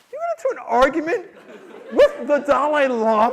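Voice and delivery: high, loud incredulous questioning; hammed-up scandalized outrage; appalled wife. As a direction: hit each phrase in disbelief.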